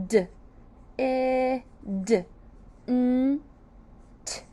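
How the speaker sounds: didn't split into d, I, d, n, t, each sound apart; sounded out letter by letter. Shown as a demonstration. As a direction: phonics sounding out for children. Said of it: "The sounds of didn't are".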